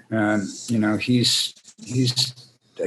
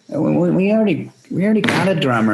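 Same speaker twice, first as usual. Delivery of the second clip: flustered reply; 7 semitones higher, 3 dB louder, faster than usual. Retold or imitated. His own reply on phone.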